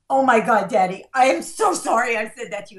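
imitated gushing apology, loud at the start; gushing teen apology. Daughter comes out to apologize to Daddy, imitated gushing apology. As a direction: act out a teenager rushing to apologize.

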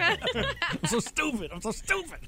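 high, slow mock whine through laughter; husband mocking his wife's regret, laughing mock wail. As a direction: ham up the regret while cracking up.

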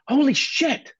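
loud, high-pitched exclamation; starstruck shock. Retold or imitated. Musician suddenly recognises [name] backstage, starstruck shock. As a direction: blurt it in amazed recognition.

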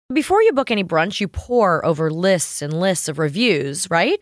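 upbeat sponsor read. Acted. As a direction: sell it to the listeners. Reads a sponsor ad.

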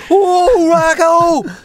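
imitating Tom as Heffer calling out to Rocco, high and loud. Hammed-up Heffer call, high and loud. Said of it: "Tom would stand his heifer".

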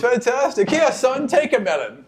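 put-on high, sweet old woman; delighted old lady. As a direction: offer the melon brightly. GM voices pleased melon woman.

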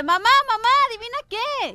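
put-on a kid bursting with news; lightly voiced excited boy; excited child.

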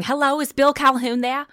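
high, loud mock shout into a phone; retold shout. Actress recalls shouting into the prop payphone, loud. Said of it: "picking up the phone and shouting".

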